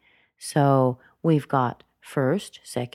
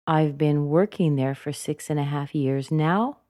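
"Becky", "firm" and "now" each lifting at the end; rising intonation. Shown as a demonstration. Teacher leading repeat-after-me, rises on "Becky", "firm", "now". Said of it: "with the rising intonation at the end of each sentence".

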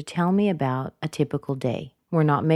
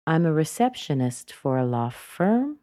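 "firm" lifted at the sentence end; rising intonation. Shown as a demonstration. Teacher modeling a continuing introduction, rise on "firm". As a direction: raise "firm" to show more is coming. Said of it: "The firm goes up".

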